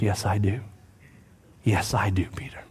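low, hushed answer; gentle reassurance. Acted. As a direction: soothe a frustrated friend, almost whispering. Jesus gently answers frustrated Peter.

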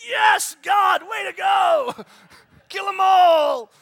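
loud high slow shout of mock glee; mock cheer. Preacher mocking revenge cheer, loud high shout. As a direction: cheer like a rowdy fan.

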